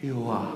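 Yuah breathed out softly, like a breath; breathy Yuah. Shown as a demonstration. Pastor showing the church Yahweh as a breath, breathy. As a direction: let Yuah out as a breath, low and soft. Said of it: "It is like breathing".

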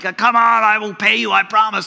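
hammed-up begging servant; pleading. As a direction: plead like a desperate debtor. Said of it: "He's pleading for it".

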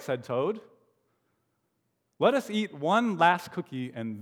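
put-on lively read-aloud for children; animated story narration; storybook reading. A narrator's reading. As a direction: read it like a bedtime picture book.

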